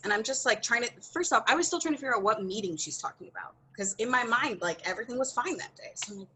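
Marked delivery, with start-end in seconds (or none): none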